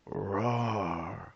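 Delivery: drawn-out roar; bear roar. Acted. Bear roaring after losing the game. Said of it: "Old Bear roared".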